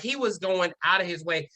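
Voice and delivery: ordinary delivery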